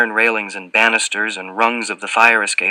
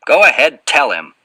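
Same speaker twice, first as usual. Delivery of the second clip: louder, sharply pitched command; 5 semitones higher, 7 dB louder than usual. A narrator's reading. Voice from inside the apartment prodding the fat man, sharp. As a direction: cut in sharply, first words high and loud. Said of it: "a sharp voice".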